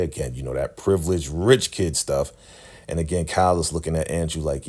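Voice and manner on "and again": usual speaking voice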